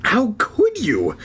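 high, slow gasping question; hurt, breathless disbelief; gasped. A narrator's reading. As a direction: gasp it out in disbelief. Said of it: "she gasped".

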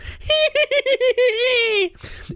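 cackle, delighted with the prank; high-pitched laughter. A narrator's reading. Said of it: "exploded in a high-pitched laughter".

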